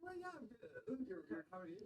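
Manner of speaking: high, soft, put-on stoner voice; airy spaced-out replay; stoned guy. High guy answering the interviewer, high and soft.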